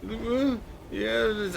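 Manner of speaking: high, tearful admission; acted weepy boy; blubbering. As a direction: answer in a small whimpering voice.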